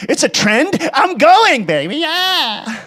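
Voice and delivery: high, airy, drawn-out mock hippie voice; mock liberal. Mocking a liberal who just follows trends, high and dreamy. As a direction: ham up a carefree trend-follower. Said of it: "you're not a liberal who just says".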